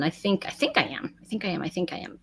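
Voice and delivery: fading from soft to barely audible; muttering to herself. Real, not acted. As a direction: mutter it, trailing away to nothing.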